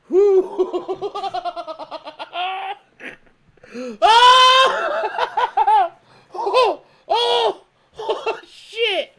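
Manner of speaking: high laughing yelps; real helpless laughter; laughing exclamations. Real, not acted. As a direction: yelp while cracking up. Cracking up at the stream.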